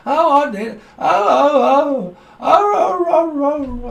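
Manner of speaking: high, loud wailing oh oh oh; mock wailing. Psychic mimics crying spirit, hammed-up high wail. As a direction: cry out like a whimpering dog.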